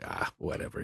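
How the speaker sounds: soft, breathy throwaway; dismissive shrug. Retold or imitated. A voice actor voices his own indifference to the industry, dropped to a whisper.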